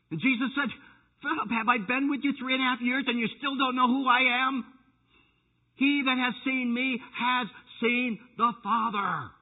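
loud, high pleading rebuke; preaching at full force. Acted. Preacher voicing Jesus rebuking Philip, loud and high.

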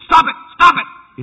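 loud, high shouted command at the bathwater; mock shout. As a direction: yell at the bathtub waves. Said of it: "stand there and go".

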